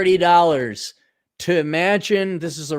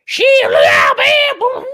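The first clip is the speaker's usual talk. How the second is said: very high, loud squeal; hammed-up squealing cry; mock shriek; 6 dB louder, much slower than usual. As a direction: screech it in a silly high voice. Acting out a pilot's squeal.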